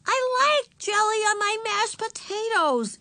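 put-on very high, slow, sulky child voice; sulky little girl. A narrator's reading. Little sister interrupting again at dinner, sulky. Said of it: "looking sulky".

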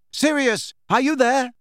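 high, loud anxious call; shouting. Acted. Harry calling through the deserted kitchen, anxious shout. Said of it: "he shouted".

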